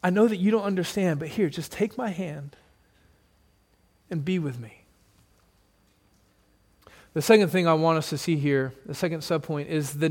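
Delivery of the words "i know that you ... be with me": much softer, gentle and reassuring; God gently comforting a grieving believer, soft and tender. Acted. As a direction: hush them like a comforting father. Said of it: "He says".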